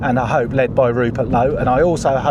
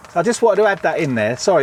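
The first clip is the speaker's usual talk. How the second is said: ordinary talk, nothing marked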